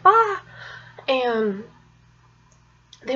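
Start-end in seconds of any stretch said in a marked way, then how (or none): 0.0-0.4 short pained yelp, high and loud; quick high yelp as the knee goes out; retold yell. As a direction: let out a sudden sharp cry of pain. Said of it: "yelling a little, like".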